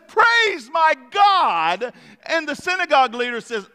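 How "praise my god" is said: slow, drawn-out cry of praise; joyful shout of praise. Acted. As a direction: shout it to God. Healed woman crying out praise.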